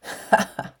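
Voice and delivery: tiefes, böses Werwolflachen; böses Lachen. A narrator's reading. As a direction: ein lauerndes, böses Lachen. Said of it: "ließ Gmorg ein tiefes, böses Lachen hören". (böses, tiefes Lachen des Werwolfs)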